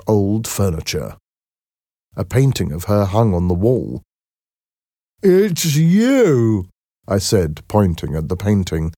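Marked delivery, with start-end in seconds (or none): 5.2-6.7 high, slow, gentle-mannered Merrick voice; timid deformed man, high and slow; Merrick's voice. A narrator's reading. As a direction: voice the shy Merrick higher and slower than the narration.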